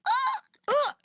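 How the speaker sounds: high, short choked squeaks; tiny high-pitched whimpers, imitated; mock choked-up yelps. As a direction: squeak out stifled emotion, hammed up. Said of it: "this total wreck of a mom".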